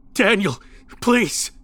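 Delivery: high, urgent begging cry; desperate plea. A narrator's reading. Runner slipping away begs Daniel, desperate high plea. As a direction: plead with rising desperation.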